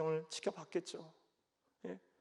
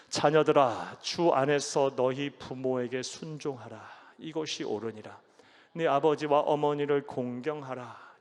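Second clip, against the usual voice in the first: scripture reading; 8 dB louder than usual. Acted. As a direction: proclaim the verse. (성경 낭독)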